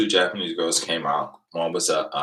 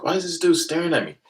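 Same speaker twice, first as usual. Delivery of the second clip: puzzled inner voice; 11 semitones higher, faster than usual. Retold or imitated. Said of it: "I'm like".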